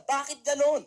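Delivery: high, loud, indignant complaint ending in a shout; outraged grandmother demanding the hat. Acted. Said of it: "Sabi niya".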